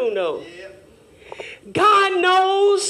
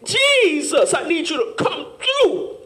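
mock pleading prayer. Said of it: "Like you striking your lucky number".

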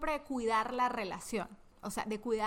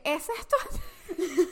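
slow, higher incredulous question with a laugh; stunned disbelief; 7 semitones higher, 5 dB louder, slower than usual. Retold or imitated. Cousin, shocked to meet the older friend, asks in disbelief. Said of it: "Y ella me dice".

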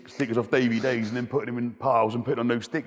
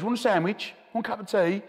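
lightly voiced mum, pitched up high; a higher-pitched take on a mum offering hospitality; imitating mum; 8 semitones higher than usual. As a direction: put on a hint of a fussing-over-guests mum. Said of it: "you come, she goes".

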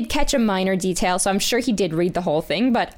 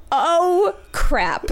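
realizing; 9 semitones higher, 3 dB louder than usual. Retold or imitated. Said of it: "to be like".

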